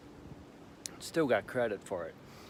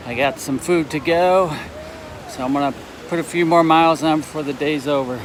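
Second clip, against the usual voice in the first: windy on a bridge; 4 semitones higher, 9 dB louder than usual. Real, not acted.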